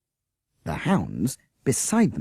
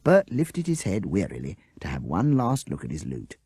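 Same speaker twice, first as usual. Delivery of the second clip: usual speaking voice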